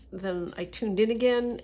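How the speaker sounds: ordinary talk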